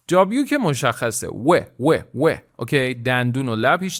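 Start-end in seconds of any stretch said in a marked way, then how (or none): none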